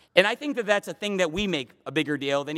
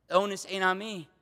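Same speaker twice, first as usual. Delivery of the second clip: usual speaking voice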